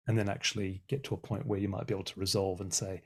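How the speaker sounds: ordinary talk, nothing marked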